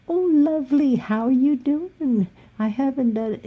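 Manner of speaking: high, syrupy imitation of her; mock sugary lady. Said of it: "and she goes".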